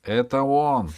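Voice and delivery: высокое громкое восклицание; воскликни, узнав его; возглас узнавания. A narrator's reading. Епископ узнаёт рыцаря. (громкий возглас узнавания)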